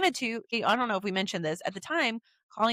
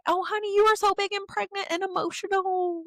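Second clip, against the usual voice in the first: high, affectionate coo; tender teasing; 10 semitones higher than usual. Retold or imitated. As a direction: soothe her like a sweetheart. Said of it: "she was like".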